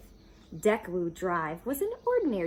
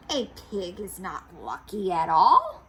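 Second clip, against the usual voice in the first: louder, sour grump; a hint of a grouchy neighbour; 8 dB louder than usual. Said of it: "She's kind of a grouchy character".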